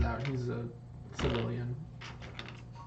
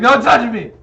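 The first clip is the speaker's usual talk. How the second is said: high, loud distraught rebuff; upset shout; 8 semitones higher, 14 dB louder than usual. Acted. Zebediah shrugs off a comforting arm, yelling. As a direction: shout him off, hammed-up upset.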